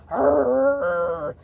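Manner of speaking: imitated low dog growl. Shark retelling the sound he heard behind him, imitated growl. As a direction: growl out a long rolling "arr". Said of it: "then I hear a".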